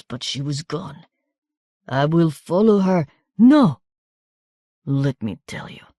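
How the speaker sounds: pained, wandering, bitter wounded voice; a hurt man raving in confusion; delirious wounded man. A narrator's reading.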